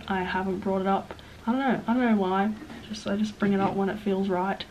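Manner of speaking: usual speaking voice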